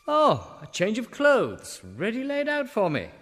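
high and loud, pleasantly surprised; delighted surprise. Acted. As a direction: gasp with delight at the find. Delighted at the clothes laid out.

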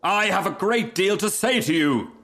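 high, insistent call; screamed. A narrator's reading. Rocket calling after the Duck, high and insistent. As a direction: demand to be heard by someone leaving. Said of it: "screamed the Rocket".

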